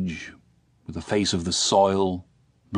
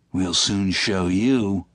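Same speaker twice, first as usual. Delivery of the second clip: growled threat; much slower than usual. Acted. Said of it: "growled Müller".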